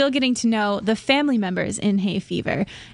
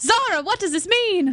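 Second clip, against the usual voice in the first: high, hammed-up British outcry; melodramatic demand; 8 semitones higher than usual. Son playing a part, melodramatic high demand. Play within the play, confrontation.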